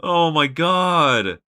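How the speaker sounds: drawn-out, loud exasperated oh my God; exasperated groan. Real, not acted. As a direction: stretch it out in disbelief. Frustration at long cutscene.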